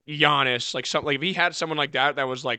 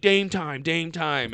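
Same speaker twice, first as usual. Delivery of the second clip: put-on baby voice; 3 semitones higher, much slower than usual. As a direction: chant it like a little kid. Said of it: "You kinda put a little baby voice on that".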